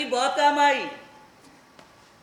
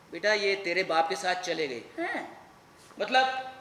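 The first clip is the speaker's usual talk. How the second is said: ordinary delivery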